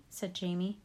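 ordinary delivery, nothing marked

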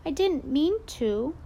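put-on pleading kid. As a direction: protest your innocence. Boy defending himself.

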